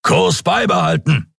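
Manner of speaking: high, curt order; captain ordering his crew; commanding. Acted. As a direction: order it without room for debate. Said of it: "befahl Sron".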